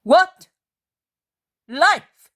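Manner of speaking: loud, high ringing cry; exultant shout of faith. A narrator's reading. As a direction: cry it out in total belief. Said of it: "a cry of absolute faith".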